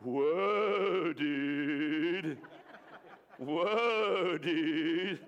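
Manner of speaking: long drawn-out surfer-style "whoa, dude"; lazy, elongated sea turtle voice. Shown as a demonstration. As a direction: stretch each word out like a laid-back sea turtle. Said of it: "And the sea turtles say".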